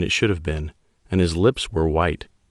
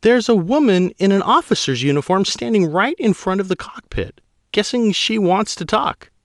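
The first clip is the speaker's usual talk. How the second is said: higher, louder woman pilot holding her ground; a pilot pushing back at her admiral; high female pilot voice, insistent; 13 semitones higher, 3 dB louder than usual. Acted. Pilot Guano's voice.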